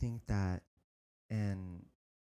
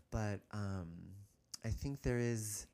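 normal talking voice

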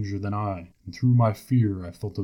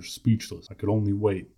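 ordinary delivery, nothing marked

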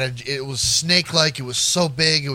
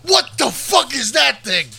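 mock terror; 6 semitones higher than usual. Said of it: "You're like".

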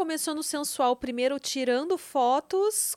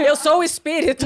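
laughing spirit voice; 8 dB louder than usual. Pretend. Friend jokes as the spirit.

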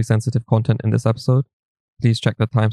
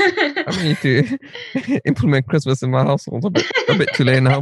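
laughing; 5 semitones higher than usual. Real, not acted. Joking about missed Christmas.

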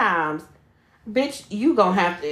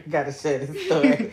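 laughing; 9 semitones lower than usual. Real, not acted. Laughing while telling.

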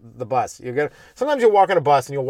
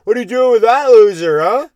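put-on loud, higher drunk guy hitting on a girlfriend; 8 semitones higher, 8 dB louder than usual. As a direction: play a sloppy loud drunk on the bus. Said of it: "there'd be some drunk guy like".

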